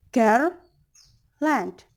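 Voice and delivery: put-on loud rising "Girl", a pause, then falling "land"; stress on first syllable. Shown as a demonstration. Teacher repeating trochaic example, stressed "Girl", separate falling "land". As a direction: hit "Girl" hard and set "land" apart. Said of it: "that's stressed syllable".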